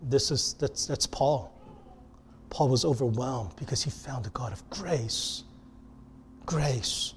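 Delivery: very soft, low, near whisper; hushed awe. Real, not acted. Preacher linking the caller to Paul, hushed and reverent. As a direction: let it sink in, barely voiced.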